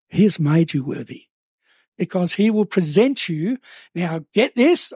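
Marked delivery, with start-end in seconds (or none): none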